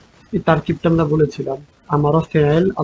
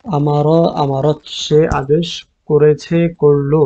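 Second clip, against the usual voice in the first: each word drawn out slowly for dictation; slow, careful teacher dictation; slowly, on request; slower than usual. Shown as a demonstration. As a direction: say it slow so students can write. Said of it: "আস্তে আস্তে বলেন".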